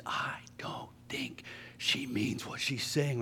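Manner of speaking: whispered aside, unvoiced and slow. Bus driver whispering a warning to the group. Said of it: "in Spanish".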